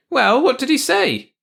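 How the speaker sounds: high, loud, slow question; demanding wife. A narrator's reading. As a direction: voice an insistent wife, pitched high. Wife questions George in story.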